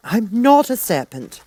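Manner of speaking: Alice sharply denying it; high indignant girl. A narrator's reading. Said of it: "said Alice indignantly".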